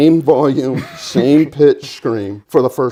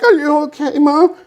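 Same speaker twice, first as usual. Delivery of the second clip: worried; 13 semitones higher than usual. Retold or imitated. Son worried on ride.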